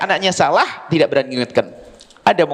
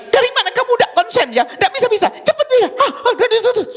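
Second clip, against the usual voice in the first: high shrill-free mock scolding, much higher; mocking angry mother. Mother scolding her child over Quran reading, high mock anger. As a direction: squeal it out like an exasperated mom.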